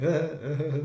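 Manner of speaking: short mock laughing fit; imitated laugh. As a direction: mimic a character cracking up. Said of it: "he starts laughing. He's like".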